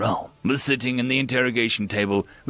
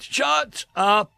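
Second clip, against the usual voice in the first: high, forceful, rattled command; angry outburst; 12 semitones higher than usual. Acted. Grice tries to stop Jennifer's probing about his wife, angry. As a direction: silence her by force of voice. Guard silences needling prisoner.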